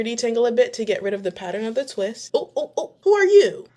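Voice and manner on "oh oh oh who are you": rising to a loud, high "who are you?"; startled by your own reflection; surprised. Real, not acted. Seeing her transformed hair in the mirror, surprised.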